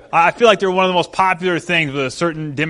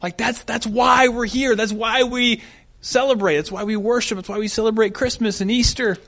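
consoling; 6 semitones higher than usual. Retold or imitated. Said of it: "I finally console her".

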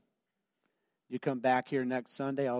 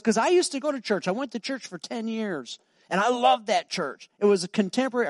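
much higher, eager and quicker; the man gushing about his old church; high, excited stranger voice; 8 semitones higher, 6 dB louder than usual. Retold or imitated.

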